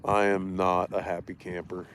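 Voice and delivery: usual speaking voice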